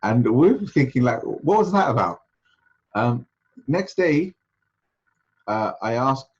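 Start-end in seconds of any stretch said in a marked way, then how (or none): 1.2-2.2 high, louder puzzled question; baffled. Retold or imitated. Students wonder about the sheikh's three slaps, retold bafflement. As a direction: act out bewilderment, pitched well up.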